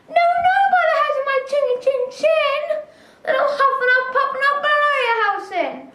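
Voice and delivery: high, fast squeaky little pig; a small pig shouting back from inside. A narrator's reading. Pig answers the wolf, high voice.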